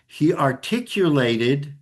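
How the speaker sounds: articulated leaned on slowly and deliberately; heavy accent on ARTICULATED. Shown as a demonstration. Teacher pointing out the key term to students, heavy stress on articulated. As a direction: draw out "articulated" with heavy emphasis. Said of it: "I'm saying that with a heavy accent".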